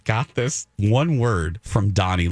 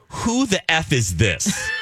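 mock hostile reply; 3 semitones higher, slower than usual. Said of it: "in quotes".

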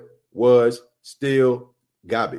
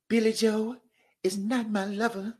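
high, soft, breathy singer voice; airy falsetto pop star impression; 9 semitones higher, 9 dB quieter than usual. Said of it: "my best [name] impression".

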